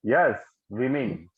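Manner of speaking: वीमेन slow and clear as "wee-min"; correct pronunciation. Shown as a demonstration. Teacher confirms a student's answer, slow "wee-min". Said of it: "So this is the correct pronunciation".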